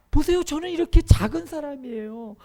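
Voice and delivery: loud, high-pitched pleading protest; Gideon's excuse. Acted. Voicing Gideon's reluctant excuse. (높고 큰 소리로 변명하며 호소)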